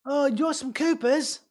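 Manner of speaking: put-on high, slow, off-target Aussie accent; badly faked Australian; attempted Australian accent. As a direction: miss the Australian accent while hamming it up. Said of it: "that's not Australian".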